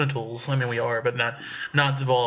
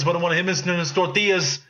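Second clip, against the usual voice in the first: ordinary talk, nothing marked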